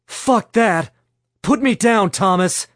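high, loud defiant yell. A narrator's reading. Stella held off the ground, yelling at Thomas to release her. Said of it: "Stella yelled".

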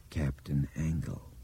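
low, slow, horrified whisper of a name; creeping horror. A narrator's reading. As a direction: let the dread dawn slowly, hushed. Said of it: "in a low, horrified voice".

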